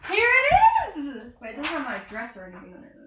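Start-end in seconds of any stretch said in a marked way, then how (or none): 0.1-1.2 excited reveal. Real, not acted. Shows licence.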